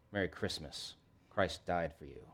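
low, soft, sincere greeting; the right way. Acted. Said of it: "there's the right way".

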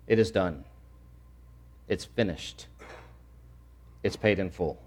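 low, soft, spaced-out phrases; grave, subdued pronouncement; hushed solemnity. Shown as a demonstration. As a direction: let each phrase fall quietly into silence. Hushed translations after the cry.

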